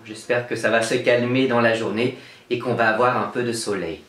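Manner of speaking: usual speaking voice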